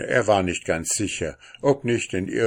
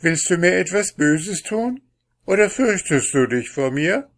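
put-on high, louder questioning girl; calling out; 9 semitones higher, 6 dB louder than usual. A narrator's reading. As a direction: keep calling questions in a raised voice. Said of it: "rief sie". (mit hoher Stimme gerufen)

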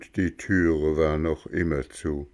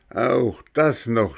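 mild angry cry; 4 semitones higher, 4 dB louder than usual. Acted. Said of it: "schrie Bengele".

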